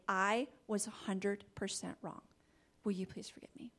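hushed, humble plea; soft sincere apology. Retold or imitated. Husband Jeff to wife, quiet humble apology. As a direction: apologize softly, almost whispering.

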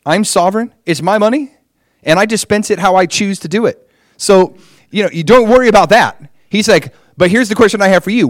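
mock a sovereign God laying it out; God correcting. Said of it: "Lord's like".

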